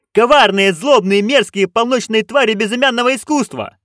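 high, loud roared list; battle roar. A narrator's reading. Witches roaring at their director, high and loud. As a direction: roar it like an army. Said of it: "witches roar full answer".

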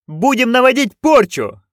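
high loud chorus bark. A narrator's reading. Witches barking their answer to the director, high and loud. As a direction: bark it together like soldiers. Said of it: "Хором гаркнули ведьмы".

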